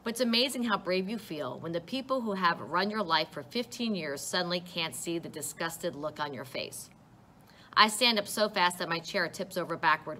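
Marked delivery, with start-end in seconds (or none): none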